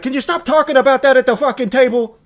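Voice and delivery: hammed-up rage at his son, blowing up over nothing; Tony yelling. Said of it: "And then Tony's like".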